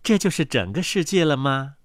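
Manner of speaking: low, soft mother duck voice; low-voiced storybook duck mother. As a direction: talk low to the ducklings, as their mother.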